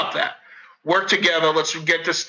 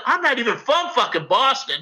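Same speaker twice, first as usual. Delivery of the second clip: indignant retort; 8 semitones higher than usual. Retold or imitated. Retorts to being asked his origin.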